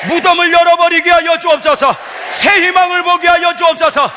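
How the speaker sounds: shouted, sustained revival prayer; fervent crying-out prayer. Real, not acted. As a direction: cry out to God at full force.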